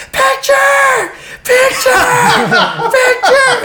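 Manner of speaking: very high, loud, drawn-out squawk; comic far-carrying yell from behind; imitated calling out. Friend on a wave demands a photo, imitated high holler. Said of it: "this voice coming behind me going".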